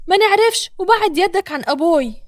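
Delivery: high, loud sharp protest; defiant woman. A narrator's reading. Mona defends her father from Yassin, high defiant voice. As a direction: jump up high and push him off.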